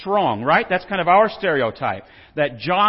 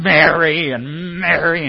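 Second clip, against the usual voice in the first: louder, drawn-out call of Mary's name, slow; calling her sister with mock exasperation; exasperated Martha; 8 dB louder, slower than usual. Martha kneading dough, calling for Mary, exasperated and slow. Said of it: "'Cause she's going".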